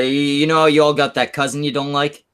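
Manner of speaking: ordinary talk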